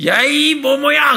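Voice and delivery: slow, loud, high insult; shouting back. Retold or imitated. Young man shouting back at the clerk, angry yell. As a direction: hurl the insult right back. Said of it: "이 청년이 또 받아쳤습니다".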